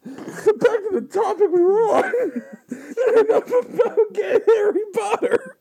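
high squeaky laugh-talk; cracking up while trying to speak; laughing hard. Real, not acted. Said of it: "laughing fit".

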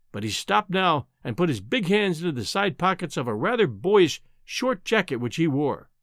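ordinary delivery, nothing marked

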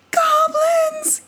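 high frightened gasp of a word; gasped in fright. Acted. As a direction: blurt it out in alarm. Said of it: "gasped Brisbane".

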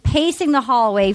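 normal talking voice

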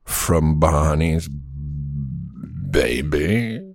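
slow, low, drawn-out artist drawl; cool artist voice. Pretend. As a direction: play the smug artist, lay it back slow. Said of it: "that's the way I'll talk".

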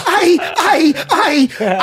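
high, loud, hammed-up stammering; mock flustered stammer. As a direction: sputter as if caught out, playfully. Acts out his flustered reaction.

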